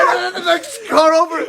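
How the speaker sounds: high, loud words through laughter; laughing hard. Real, not acted. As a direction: push it out while cracking up. Cracking up while adding to skit.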